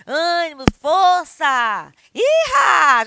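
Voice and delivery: loud high rallying cries; encouraging shouts. Shown as a demonstration. Teacher demos encouragement, loud rallying shout. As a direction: pump them up.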